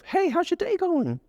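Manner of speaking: slow, squeaky high greeting; high-pitched. Shown as a demonstration. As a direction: lift it way up into a cartoonish high voice. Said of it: "instead of".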